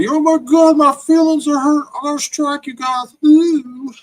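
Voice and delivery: high, loud, mocking whine; mocking whiny quote. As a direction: mimic a thin-skinned crybaby in a high squeal. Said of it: "Striking a fucking innocent channel".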